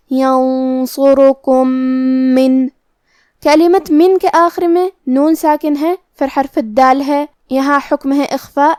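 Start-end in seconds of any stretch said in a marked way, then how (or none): 0.1-2.7 ینصرکم من slowly, the two میم joined into one doubled nasal meem; merged meem. Shown as a demonstration. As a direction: join ینصرکم into من with ghunna. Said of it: "ایک ہی میم غنہ اور تشدید کے ساتھ". (ادغام میم غنہ کے ساتھ)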